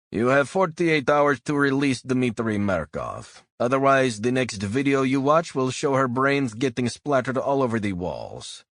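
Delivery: put-on Russian kidnapper's threat in a Russian accent. A narrator's reading. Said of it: "a voice with a Russian accent said".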